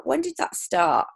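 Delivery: normal talking voice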